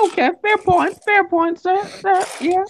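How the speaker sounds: squeaky joke voice; high silly voice. Pretend. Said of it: "does everybody like my new voice".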